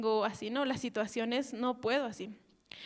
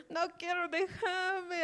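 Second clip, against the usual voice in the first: 1 queja gritada; 7 semitones higher, slower than usual. Retold or imitated. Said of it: "quiero gritar".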